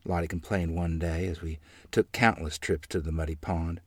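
plain reading voice

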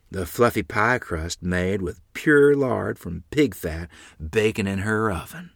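plain reading voice

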